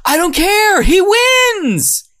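very high, loud bellowing; full hammed-up shouting match; furious mock shout. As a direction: roar it like a furious reality-TV dad. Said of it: "the next one is saying".

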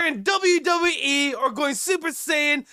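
high-pitched hype-man yell; hype shouting. Pretend. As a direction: shout like a wrestler pumping the crowd. Hyping up podcast, wrestler style.

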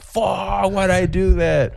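high, drawn-out mock frustration; pissed at himself. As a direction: kick yourself comically, stretching the words out. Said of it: "I was pissed".

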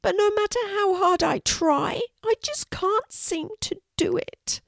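very high, small voice; sad little dragon. A narrator's reading. Norman confesses he cannot breathe fire, sad little dragon. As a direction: squeak it high and dejected. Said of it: "said Norman sadly".

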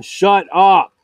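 loud annoyed yell; real shout. Real, not acted. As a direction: shout the bird down. Yelling at the bird again.

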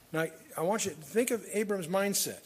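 ordinary talk

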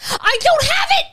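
high, loud frantic cry; panicked shout. Real, not acted. Panicking, link still missing.

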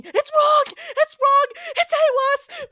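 very high, very loud terrified screaming; hysterical shrieking. Acted. Catherine shrieking in terror at the others, panicked screams. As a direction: shriek in panic, begging them all. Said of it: "starts shrieking".

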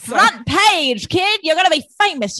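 put-on old-timey newsreel hustler; fast-talking 1940s movie man. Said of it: "Channel old movie voices".

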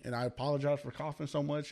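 normal talking voice

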